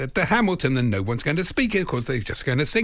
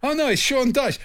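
surprised; 11 semitones higher than usual. Retold or imitated. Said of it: "And he went".

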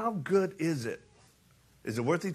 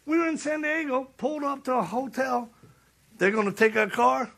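normal talking voice

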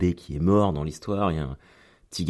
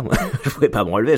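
laughing; 6 semitones higher, 5 dB louder, much faster than usual. Real, not acted. Laughing retelling being stuck.

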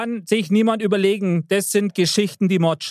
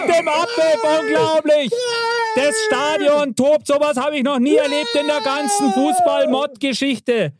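excited commentator; 7 semitones higher than usual. Pretend. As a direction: call the last-second goal like a commentator losing it. Mock commentary after winning goal.

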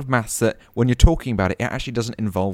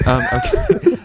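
laughing; 3 dB louder, slower than usual. Real, not acted. Surprised at being told wrong.

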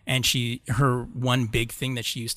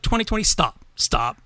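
high, loud exasperated begging to stop; begging the year to quit, half-joking; mock pleading; 6 semitones higher, 5 dB louder than usual. Scolding the year 2020 over locusts and hornets, mock pleading. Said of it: "it's like".

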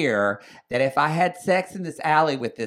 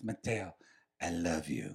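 hushed lover's I love you, much softer; tender whisper-soft confession; 9 semitones lower, 10 dB quieter than usual. Retold or imitated. Retelling Adolfo's sudden confession, soft and hushed. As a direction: breathe it softly like a besotted lover. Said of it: "He says".

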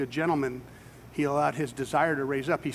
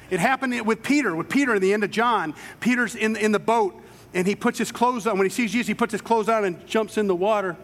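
loud, higher, driving sermon voice; full-force preaching; 6 semitones higher, 5 dB louder than usual. Real, not acted. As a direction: preach it hard to the back row.

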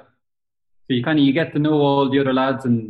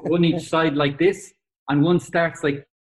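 normal talking voice

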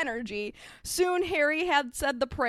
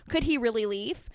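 usual speaking voice

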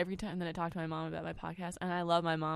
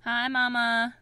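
loud, bright call into the phone; loud greeting; 4 semitones higher, 11 dB louder, slower than usual. Real, not acted. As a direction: call out to mom on speaker. Calling loudly to phone.

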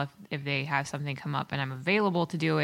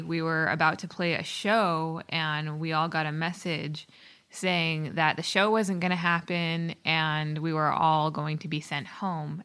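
ordinary talk, nothing marked

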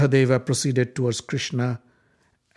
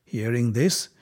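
plain reading voice

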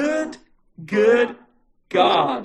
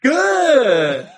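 louder, higher game-show cry of Good; loud host shout; 7 semitones higher, 6 dB louder than usual. Acted. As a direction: yell it like a quiz host.

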